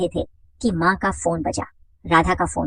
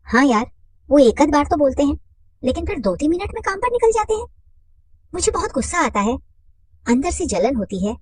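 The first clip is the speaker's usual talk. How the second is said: higher, grumbling housewife; complaining woman's voice; 7 semitones higher than usual. Acted. Mother complaining to her friend Radha, higher. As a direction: voice her irritation up high.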